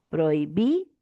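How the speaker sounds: PROI-BI rising, pause, then DO; split into syllables. Shown as a demonstration. Teacher showing how to separate prohibido, syllable by syllable. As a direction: break "Prohibido" before the final "do". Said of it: "¿cómo se va a separar prohibido?".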